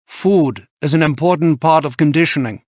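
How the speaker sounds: put-on loud, high German-accented lecture; German climber laying down rules; admonishing German climber. A narrator's reading. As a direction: admonish him in an imperious German voice. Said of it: "Freitag admonished".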